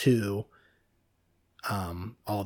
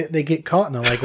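ordinary talk

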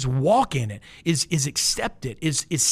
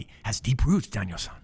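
Mister Miyagi; 6 semitones lower than usual. Retold or imitated. Preacher jokingly playing Miyagi to his congregation, lightly voiced. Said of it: "I feel like I sound like Mister Miyagi".

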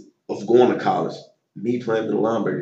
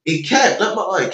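teammate calling out; 11 semitones higher, 6 dB louder, slower than usual. Retold or imitated. Teammate voiced calling coach.

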